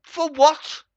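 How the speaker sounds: loud high yelled question; outraged shout. Real, not acted. Vlogger raging at MPs' pay, outraged shout. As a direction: demand it in disbelief. Furious rant about pay.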